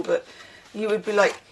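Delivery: ordinary talk, nothing marked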